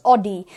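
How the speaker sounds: Audi as "AW-dee", single open vowel; mispronunciation. Shown as a demonstration. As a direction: begin Audi like "awe". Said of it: "हम गलत pronounce करते हैं". (Audi का गलत उच्चारण, "ऑडी")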